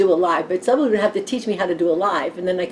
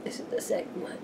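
soft unvoiced whisper; 13 dB quieter than usual. Real, not acted. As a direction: murmur it to yourself. Whispered aside to self.

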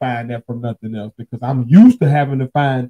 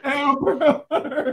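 laughing alarm call; 10 semitones higher, slower than usual. Pretend.

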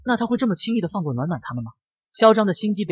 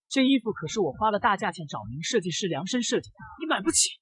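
arrogant; 5 semitones higher, 5 dB quieter than usual. A narrator's reading. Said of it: "十分嚣张地说道".

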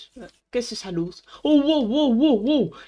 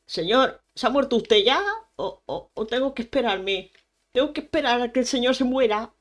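normal talking voice

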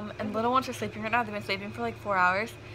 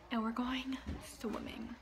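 whispering; 15 dB quieter, slower than usual. Real, not acted. Whispered late-night aside.

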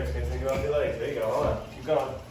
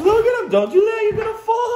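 excited; 8 dB louder, faster than usual. Real, not acted. Dad laughing as child tangles.

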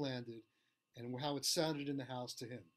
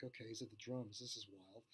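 stunned inner thought; 5 semitones lower, 12 dB quieter than usual. Retold or imitated. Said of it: "I'm like".

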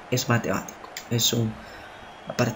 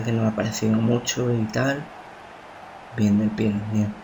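normal talking voice

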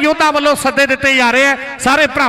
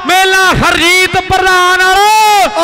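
drawn-out hype; 4 semitones higher, slower than usual. Real, not acted. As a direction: stretch every word to hype the crowd. Hyping the big crowd.

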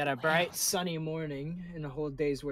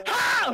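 loud high shriek of fright; startled scream; 11 dB louder than usual. Real, not acted. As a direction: let out a sudden scream. Door scare, loud cry.

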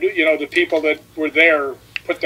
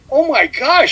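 high, gasping mock alarm; imitating girl's shock; 10 semitones higher, slower than usual. Girl touching Rick's forehead, in mock high shock. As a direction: jump up high as the startled girl. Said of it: "She goes".

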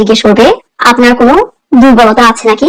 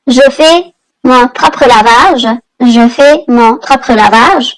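drawn out, syllable by syllable; very slow model phrase; much slower than usual. Shown as a demonstration. Teacher showing Bengali learners a French phrase, very slow. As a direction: spell the phrase out slowly for the class. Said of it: "teacher models a French phrase".

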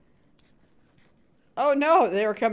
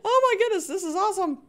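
high and loud, bursting with delight; thrilled cheer; 10 semitones higher, 4 dB louder than usual. Real, not acted. Streamer cheers Gamer Dude's win, thrilled and high.

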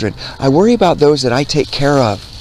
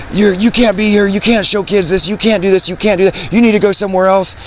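higher-pitched mock protest; imitated scolding objectors; 8 semitones higher than usual. People objecting to him at a fair, imitated scolding. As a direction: rattle off the complaints, imitating indignant passers-by.

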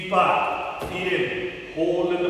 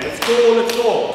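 coach shouting; 4 semitones higher, 6 dB louder than usual. Real, not acted. Coach calling out during workout.

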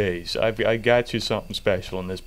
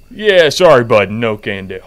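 shouted through the door, louder; loud call through door; 12 dB louder than usual. Real, not acted. Shouting through door at old man.

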